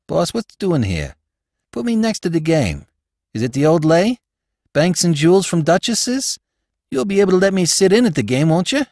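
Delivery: eager, slangy street crook; Spike, eager crook. Acted. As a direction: beg the boss to be let in on the job.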